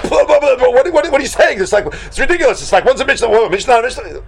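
high-pitched flustered sputtering; acted flustered disbelief; taken aback. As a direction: stammer it out, too stunned to form the question. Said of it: "was so taken aback".

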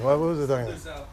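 ordinary delivery

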